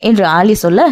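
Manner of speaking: plain reading voice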